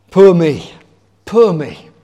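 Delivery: loud hammed-up whine of poor me; mock self-pity. Preacher showing the congregation what moping sounds like, mock whine. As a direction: act out feeling sorry for yourself. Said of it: "That's, that's moping".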